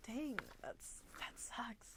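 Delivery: soft whispered dang, that sucks; soft whispered letdown; whispered sympathy. Real, not acted. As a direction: whisper it under your breath. Sympathetic aside.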